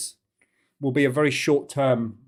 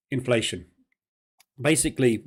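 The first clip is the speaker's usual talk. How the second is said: ordinary delivery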